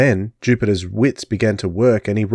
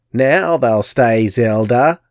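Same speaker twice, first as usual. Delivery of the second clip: put-on slow, louder old hag cackle; cackling crone; 4 dB louder, slower than usual. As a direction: cackle with satisfaction.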